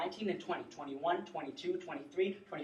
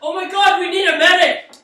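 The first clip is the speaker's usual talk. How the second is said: man down shout; 12 semitones higher, 19 dB louder than usual. Acted. Bystander alarmed by injury.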